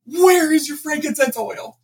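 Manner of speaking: frantic customer bursting in. Retold or imitated. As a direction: burst in demanding the oil in a panic. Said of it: "he's frantic".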